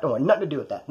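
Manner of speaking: usual speaking voice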